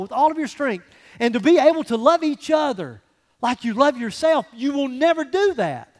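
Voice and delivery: loud, high, hammering pulpit delivery; fervent sermon at full volume; full-force preaching. Acted. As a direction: preach it hard, punching each won't. Full-force preaching on trust, love.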